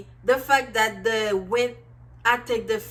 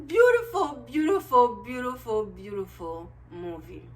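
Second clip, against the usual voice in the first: fading praise. Real, not acted. Vlogger praising the movie, fading to a hush. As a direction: let the praise trail away.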